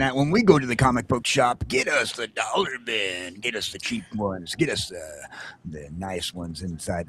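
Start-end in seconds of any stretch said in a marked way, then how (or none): none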